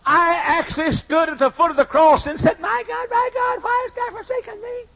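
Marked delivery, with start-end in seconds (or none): 2.6-4.8 high, softer sneering parody of the cry; mocking. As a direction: mimic his anguish in contempt. Sinner mocking Jesus's cry.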